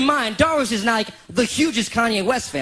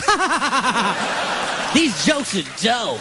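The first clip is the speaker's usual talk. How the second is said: hammed-up laughing, then slow drawl; exaggerated pleased-with-yourself act; mock self-admiring laugh; 3 semitones higher, much slower than usual. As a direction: cackle at your own jokes. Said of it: "I was listening to it going".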